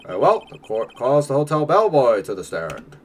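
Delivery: lightly voiced courtroom judge. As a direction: announce it like a formal judge. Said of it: "my judge voice and gumshoe voice are slightly different".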